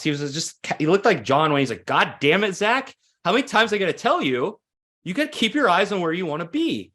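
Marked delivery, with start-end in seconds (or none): 1.9-6.9 high, pressed-out coach's scolding, half-mock; cussing coach. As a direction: hammer it home like an exasperated John Wayne-type coach. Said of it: "And he always cussed".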